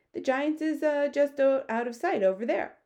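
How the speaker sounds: high, loud storybook giant; friendly giant. Acted. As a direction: pitch it up as an odd, earnest giant.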